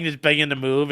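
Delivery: usual speaking voice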